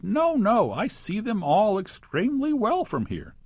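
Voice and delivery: crying out in despair. A narrator's reading. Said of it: "he cried".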